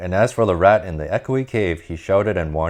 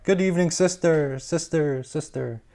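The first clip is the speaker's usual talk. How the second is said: quacked call; 10 semitones higher than usual. Acted. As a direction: call into the cave, dying away. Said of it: "She quacked".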